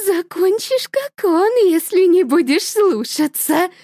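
high, menacing threat; mother threatening daughter. Acted. (высоко, угрожающе)

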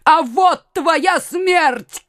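high, slow, vengeful cry. A narrator's reading. Mother at window dooms her son's killer, crying out. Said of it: "крикнула мать".

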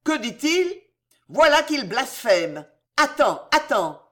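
high, agitated exclamations; stammering outrage. A narrator's reading. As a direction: start incredulous, then threaten. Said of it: "bégaya Charles".

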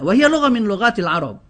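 ordinary talk

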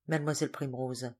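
ordinary delivery

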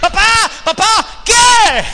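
very high, very loud shouting; shouted exchange. Acted. Boy screams and Abraham finally shouts back, very high and loud. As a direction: shout it, the breaking point.

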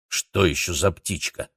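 plain reading voice

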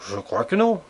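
shouting back, moderately loud; called-out reply. A narrator's reading. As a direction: call the answer back to them. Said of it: "je leur criai".

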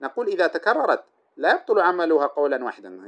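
ordinary talk

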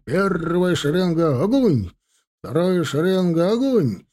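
high, slow shouted orders; combat callout. Acted. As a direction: yell fire commands down the line. Officer commanding volley fire.

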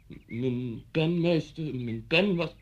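slow, halting schoolboy excuse; stammering pupil. Retold or imitated. Former pupil re-enacting his excuse to teacher, stammering. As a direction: imitate a nervous child stumbling over an excuse. Voices himself as frightened pupil.

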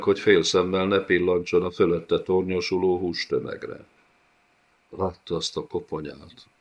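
plain reading voice

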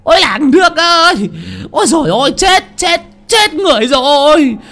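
shrill panicked wailing scream; burning scream. Acted. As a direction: scream for help in terror. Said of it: "tiếng la hét thất thanh". (hét thất thanh hoảng loạn)

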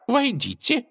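high, curt command; curt princess. Acted. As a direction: toss it off without looking. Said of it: "бросала только". (коротко, сухо)

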